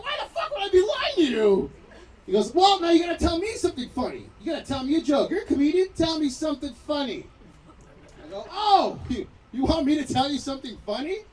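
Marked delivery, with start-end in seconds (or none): 0.0-1.7 high, loud indignant question; exasperated retort. Retold or imitated. 2.5-7.3 high, loud insistent orders; demanding cop. Retold or imitated. 8.5-11.2 high, loud echoed question; mock incredulity. Incredulous reply to cop's demand.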